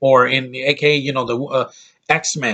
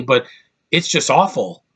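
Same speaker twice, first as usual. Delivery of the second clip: ordinary delivery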